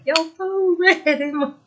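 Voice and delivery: high, put-on child's voice; squeaky imitation of a kid calling; imitating Kitten. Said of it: "Kitten was up talking about".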